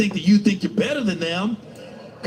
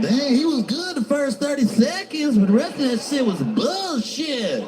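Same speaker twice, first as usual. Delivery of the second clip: a higher mocking critic voice; heckling audience member; 6 semitones higher than usual. Fan mocking the comic's special, jeering. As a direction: sneer it like a let-down fan. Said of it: "Be like".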